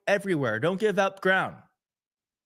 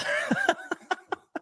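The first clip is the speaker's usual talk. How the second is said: high, bright nervous giggle; emotional laugh. Real, not acted. Host moved by the chat, giggling. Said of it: "making me emotional".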